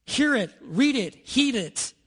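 three short loud high beats; punchy triple. Real, not acted. Preacher urging the church to the Word, punched loud. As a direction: hit each command hard. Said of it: "three-beat exhortation".

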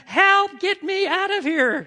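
put-on high, loud cry of a trapped toddler; screaming. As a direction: yell desperately to be let out. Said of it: "And he is screaming".

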